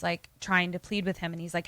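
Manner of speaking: ordinary delivery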